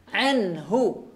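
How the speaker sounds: anhu with a clear ain from mid-throat; ain demo. Shown as a demonstration. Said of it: "Jangan sampai tertukar dengan huruf hamzah". (anhu dengan ain jelas dari tengah tenggorokan)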